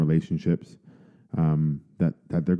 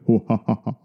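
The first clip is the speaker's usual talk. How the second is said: evil chuckle; 3 semitones higher, faster than usual. Acted. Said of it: "that evil villain laugh".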